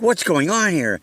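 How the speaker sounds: high-pitched retold alarm; mock startled question; imitating startled landlord. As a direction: mimic a landlord taken aback, pitched well up.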